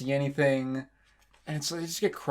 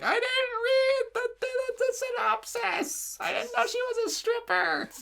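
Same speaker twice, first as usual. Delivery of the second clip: mom impression. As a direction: shriek it like a scandalized mom. Imitating mom's shocked words.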